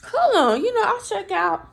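high startled hold on; shocked. Real, not acted. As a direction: squeak it out, startled. Shocked reaction at checkout.

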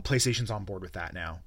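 ordinary talk

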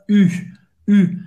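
"U" as an I through O-rounded lips; rounded French U. Shown as a demonstration. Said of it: "Faz a boca do O e fala I". ("U" francês, boca de O falando I)

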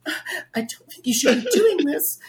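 very high, thin mock-polite voice. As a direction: imitate timid reproof way up high.

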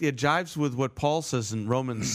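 ordinary talk

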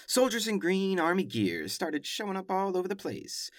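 normal talking voice

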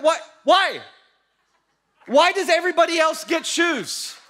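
high, indignant mock shout; imitated angry protest. Albie protesting that everybody gets shoes, mock angry shout. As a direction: wail at the unfairness, hammed up. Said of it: "He starts getting angry".

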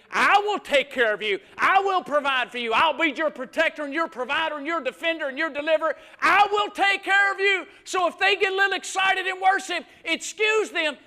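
loud, high, emphatic promise; full-force preaching. Acted. God promising care to the hurting, thundered by preacher. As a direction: proclaim it at the top of the pulpit voice.

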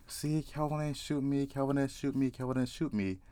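high, soft taunting chant; retold boasting. Retold man boasting he survived.

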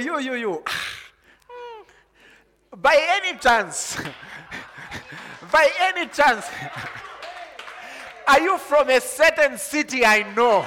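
high, loud, laughing questions to a stranger; excited questioning. Retold or imitated. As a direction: call out to the stranger, thrilled and laughing. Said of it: "And you say".

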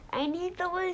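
high-pitched plea without extra volume; urgent little character's high plea; mild storybook scream. A narrator's reading. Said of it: "screen, poor old Lou".

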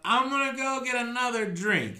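put-on high drunken voice. Drunk Skruldar staggering off.

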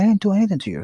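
high, loud indignant protest. Retold or imitated. Telling his sister he's innocent, high indignant outburst.

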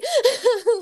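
high giggle. Real, not acted. Guest laughs about clients giggling, high giggle. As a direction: burst of high delighted giggling.

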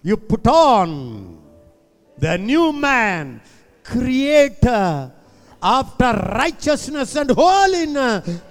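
loud, slow proclamation; forceful preaching. Real, not acted. Forceful declaration.

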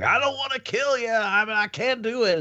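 high, quick mock anguish; Tom Hanks. Host acting the guard refusing to kill, high pleading imitation. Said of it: "Tom Hanks was like".

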